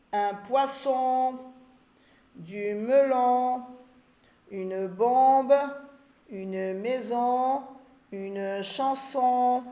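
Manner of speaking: each word slow with the last sound held; slow word reading. Shown as a demonstration. Teacher reading the word list slowly to find the odd one. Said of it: "O N".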